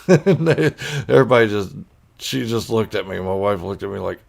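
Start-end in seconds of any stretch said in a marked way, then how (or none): none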